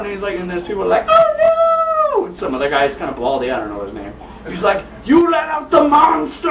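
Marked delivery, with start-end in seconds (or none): 1.1-2.2 very high, loud falsetto cry; mock panicked wail. Bystanders see everyone running, mock panicked wail. 5.1-6.5 high, loud, drawn-out accusation; mock horrified shout. Man blames the guy who freed the monster, mock shout.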